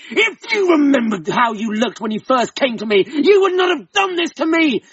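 furious husband screaming at his wife; screaming rage. A narrator's reading. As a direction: scream it at the woman. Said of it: "He screamed with rage".

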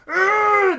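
very high, loud roar of fury; imitated furious scream; enraged scream. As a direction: explode in a wordless yell. Enraged reaction to insult.

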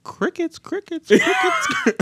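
very high, squeaky chirping; escalating high-pitched cricket bit; mock crickets. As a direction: chirp the word insistently like a cricket. Mimics awkward silence with cricket calls.

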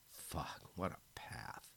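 soft unvoiced whisper; hushed private curse. A narrator's reading. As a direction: breathe it out bitterly to yourself. His bitter inner thought.

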